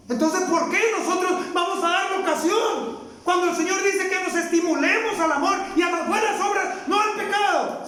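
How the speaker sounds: rally the church toward good works; preaching at full force. Acted. Preacher exhorts again.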